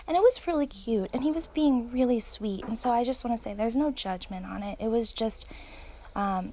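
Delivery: usual speaking voice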